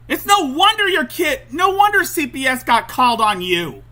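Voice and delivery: high, loud outraged yelling; real full-voiced angry outburst; furious shouting. Real, not acted. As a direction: yell it at a negligent mother. Furious reply to played clip.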